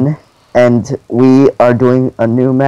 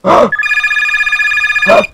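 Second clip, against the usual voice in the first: huge high overacted gasp; dramatic gasp. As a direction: gasp in mock horror. Reacting to horror map cutscene.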